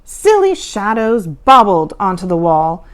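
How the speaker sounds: plain reading voice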